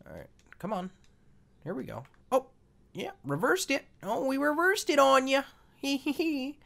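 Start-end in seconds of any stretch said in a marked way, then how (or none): 2.3-6.6 high, sing-out gloating with a giggle; gleeful taunt. Pretend. Player gloating over a reversal in the game, high gleeful taunt.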